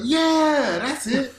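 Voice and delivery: high, loud shout of approval; excited mentor. Retold or imitated. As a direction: imitate a teacher bursting out in delight. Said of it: "And he's like".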